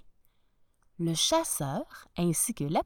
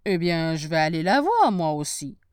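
wolf voice; 4 semitones lower, 6 dB louder than usual. Acted. Wolf plots aloud.

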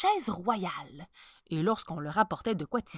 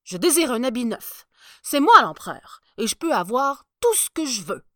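much higher, much louder pompous emperor; a spoiled ruler who wants everything now; haughty emperor booming out his orders; vain emperor; 5 semitones higher, 12 dB louder than usual. Acted. Emperor insists.

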